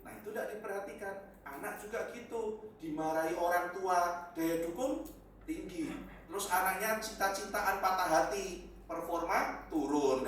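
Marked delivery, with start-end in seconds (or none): none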